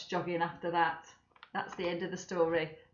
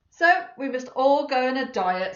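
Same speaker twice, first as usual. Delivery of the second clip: firm announcement; 4 semitones higher, 8 dB louder than usual. Acted. Mother announcing diet.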